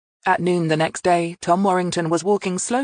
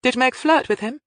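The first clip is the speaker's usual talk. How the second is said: crisp, higher-pitched question; 8 semitones higher, 4 dB louder than usual. A narrator's reading. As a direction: put the question briskly and pointedly. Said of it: "Hilary asked crisply".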